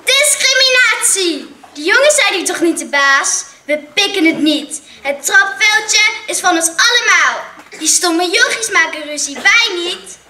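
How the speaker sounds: high, loud protest; outraged shouting girls. A narrator's reading. Girls shouting at the teacher about the boys, loud. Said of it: "Christel schreeuwde het hardst".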